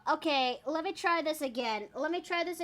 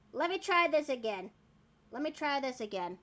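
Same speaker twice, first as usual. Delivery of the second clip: ordinary talk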